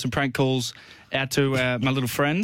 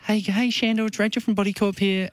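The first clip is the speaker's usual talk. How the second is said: higher-pitched put-on female caller; girl's voice; 7 semitones higher than usual. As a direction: pitch it up like a woman making a prank call. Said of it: "I actually put a girl's voice on".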